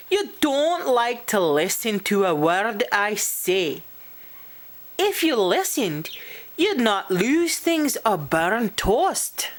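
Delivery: high-pitched, fussy telling-off; prim little fairy laying down rules; scolding fairy. Acted. Pish scolds Posh.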